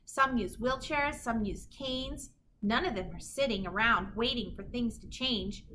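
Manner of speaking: plain reading voice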